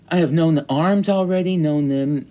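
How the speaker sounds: high, loud, declaiming the line to the back row; dramatic loud reading. Acted. Teacher reading Prufrock aloud to class, loud dramatic recital. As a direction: throw the verse out with full force.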